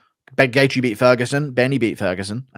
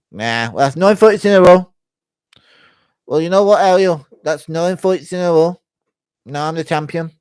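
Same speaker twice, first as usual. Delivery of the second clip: raised, louder put-on British voice; Leon Edwards impression; 7 semitones higher, 6 dB louder than usual. Leon Edwards telling Ariel of his streak, high loud impression. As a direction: boast in a higher British accent. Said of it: "Leon Edwards impersonation".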